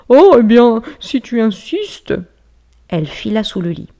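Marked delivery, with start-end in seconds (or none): 0.1-2.1 reluctant bear. Acted.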